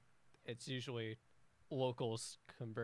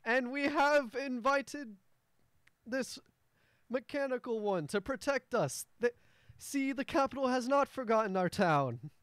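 the dwarf mayor unveiling his mechanical protector; grand mayor announcement; 11 semitones higher, 11 dB louder than usual. Acted. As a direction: trumpet the news to the whole square. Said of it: "And the mayor goes".

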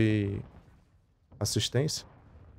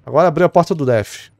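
loud callout; 4 semitones higher, 9 dB louder than usual. Real, not acted. Excited callout.

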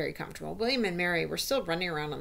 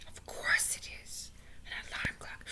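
awed whisper; 9 dB quieter than usual. Acted. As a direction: whisper it in hushed realisation. Audience marvels at the revealed secret.

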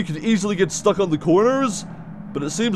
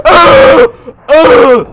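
loud, high startled screams; real jump-scare shriek; scared scream; 8 semitones higher, 10 dB louder than usual. Real, not acted. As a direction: scream twice in sudden fright. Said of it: "that actually scared me".

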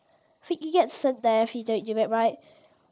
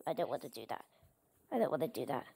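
much lower and softer, muttered; low mumble; 12 semitones lower, 13 dB quieter, much faster than usual. Real, not acted. Gamer dreading the acid, low mumble. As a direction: mutter it reluctantly under your breath.

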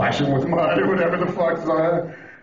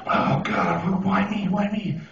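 mock-miserable, higher-pitched plea; self-pitying lament; 6 semitones higher, slower than usual. Stranded driver pushing the car, self-pitying. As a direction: bemoan your luck in a raised pitch.

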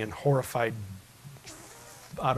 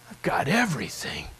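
whisper. Real, not acted. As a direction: breathe it out as a confession. Quiet realization after verse.

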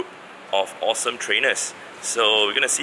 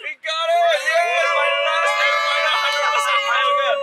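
excited shouting; 6 dB louder, slower than usual. Real, not acted. Catching the hundred percent Kyogre.

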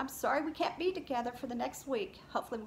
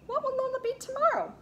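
excited question; 14 semitones higher, 7 dB louder than usual. Acted. Teacher quizzes children on story.

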